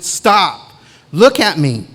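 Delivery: loud, sharp order to stop and look; forceful command. Retold or imitated. Elder grabbing his arm on the stairs, commanding loudly. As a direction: make him stop in his tracks and listen.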